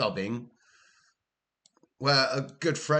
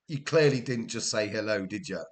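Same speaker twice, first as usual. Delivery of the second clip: usual speaking voice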